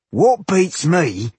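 high, slow, carrying villager voice. A narrator's reading. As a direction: raise it so the whole tavern hears. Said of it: "raising his voice so everyone in the tavern could hear".

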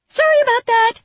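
very high, loud chirpy apology; very high fairy. Acted.

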